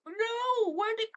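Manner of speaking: high, loud alarmed shout; startled cry. Real, not acted. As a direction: yelp in sudden dismay. Dropped the pen.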